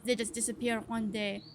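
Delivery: suspicious farmer with an attempted French accent; hammy French-accented farmer. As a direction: confide it in a rough French accent. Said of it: "is this a French accent?".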